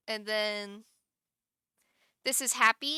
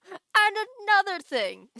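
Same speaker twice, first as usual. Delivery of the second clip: higher, louder hammed-up indignant shout; mock angry rant; 10 semitones higher, 8 dB louder than usual. Streamer voicing her angry emote, hammed-up shouted rant. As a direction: really lean into another thing like a cartoon rant. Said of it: "here's me being angry".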